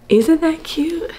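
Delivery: high, loud squeal of delight; delighted gushing. Real, not acted. Vlogger showing off her anniversary shirt design, delighted gushing. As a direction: gush over something adorable.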